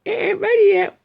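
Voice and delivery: high, loud falsetto mum; hammed-up high-pitched mum voice; mum answering child. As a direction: call back as a put-on mum. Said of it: "saying to his mum".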